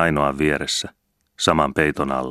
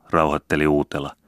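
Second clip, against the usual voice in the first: ordinary delivery, nothing marked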